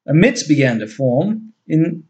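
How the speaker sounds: plain reading voice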